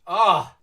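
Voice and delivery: a sudden loud exasperated "ah"; frustrated shout. Real, not acted. Fan choking on outrage over the bad song, loud frustrated cry. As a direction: burst out in pure frustration. Frustrated outburst mid-rant.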